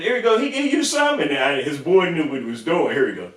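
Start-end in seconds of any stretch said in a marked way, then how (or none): 0.0-1.2 much higher and louder, incredulous; high mock driver voice. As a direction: squeak the question. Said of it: "he had".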